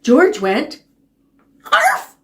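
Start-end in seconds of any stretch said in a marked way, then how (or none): 1.7-2.1 high excited arf; dog bark. Acted. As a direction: bark like a puppy. Dog finally barks.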